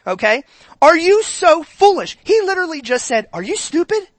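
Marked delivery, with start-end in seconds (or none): 0.8-2.2 high, slow, snarky question; sarcastic. Shown as a demonstration. Pastor voicing Paul's snarky question, high and slow. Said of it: "That's sarcasm". 3.3-4.1 high, mocking jab; blunt sarcasm. Shown as a demonstration. Pastor translating Paul bluntly, high mocking.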